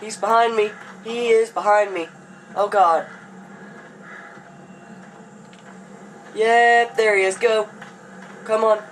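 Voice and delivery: loud, high frightened shouting; panicked shouting. Real, not acted. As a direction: shout in terror while being chased. Monster chasing him, panicking.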